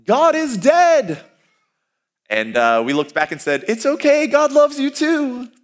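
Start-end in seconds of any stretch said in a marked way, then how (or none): 0.0-1.2 high, loud, slow mocking yell; drunk man's yell. Man at the bar window yelling at the students, loud and high. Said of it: "open up the window and yell". 3.7-5.5 high, slow sing-song sweetness; mock sweet reply. Students answering the heckler, high and syrupy sweet.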